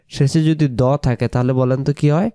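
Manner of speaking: normal talking voice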